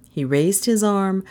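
usual speaking voice

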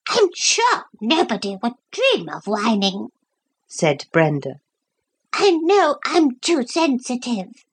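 high, fussy little dog voice; a wheedling lapdog fishing for cocoa; Brenda the dog. A narrator's reading. Brenda the dog begging Lucy for cocoa, high and fussy.

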